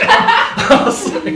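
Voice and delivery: very high, loud, breathless laugh; helpless laughter. Real, not acted. Telling friends about mailing an iPhone, bursting into laughter. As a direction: crack up at his own story.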